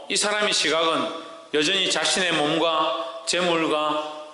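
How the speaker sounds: normal talking voice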